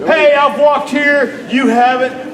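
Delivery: loud, high, slowed boast; mock braggart shout, the way not to do it; bragging shout. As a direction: shout it in their face. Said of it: "But not like".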